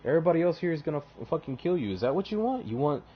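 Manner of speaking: high, whiny wheedling voice; pleading schemer. Retold or imitated. Erebus pleading with Torgodon to spare him, high wheedling whine.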